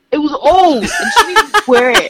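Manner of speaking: high loud words through laughter; laughing. Real, not acted. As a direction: crack up mid-sentence. Laughing while telling story.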